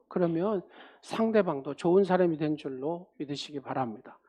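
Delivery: usual speaking voice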